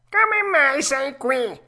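high, loud cat echo. A narrator's reading. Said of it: "ripeté il gatto".

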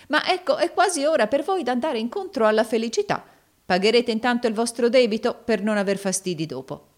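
high, loud, pressing character voice; brisk moneylender. A narrator's reading. Rodolfo sending the suitor off and demanding payment, pressing tone.

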